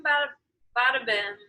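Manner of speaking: normal talking voice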